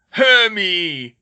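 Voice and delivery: giant's loud, high, stretched call; thunderous happy holler; Gropp, thundering. Acted. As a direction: bellow the name like a simple giant. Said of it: "thundered Gropp".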